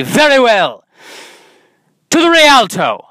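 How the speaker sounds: loud, high, grandly wounded Shakespearean exit line; bad Shakespearean accent. Rejected suitor leaving dramatically, bad Shakespearean accent. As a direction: proclaim your exit like a hammy Shakespearean. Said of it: "in a bad Shakespearean accent".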